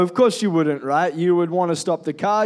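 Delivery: ordinary delivery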